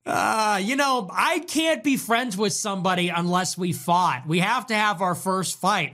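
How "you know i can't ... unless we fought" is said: usual speaking voice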